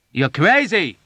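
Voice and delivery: high loud angry shout. A narrator's reading. Yossarian shouting angrily at Nurse Duckett. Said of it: "Yossarian shouted angrily".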